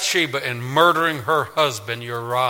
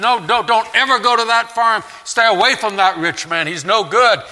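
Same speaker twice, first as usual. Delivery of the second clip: higher, louder alarmed warning; stern father; 8 semitones higher, 6 dB louder than usual. Retold or imitated. Father warning his children, higher urgent voice. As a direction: warn them off that farm. Father warns kids in story.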